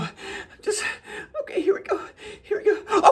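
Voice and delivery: high, breathy, whimpering build-up; mock panic. Bracing himself to let go of control, panicky. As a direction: brace for the plunge, voice climbing into a cry. Acts out nerve failing before surrender.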